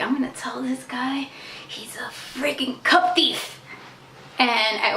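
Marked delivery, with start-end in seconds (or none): 0.0-3.6 whispered softly under her breath; scheming whisper. Retold or imitated. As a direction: hiss it like a secret plan against the delivery guy. Plotting aside, unvoiced whisper.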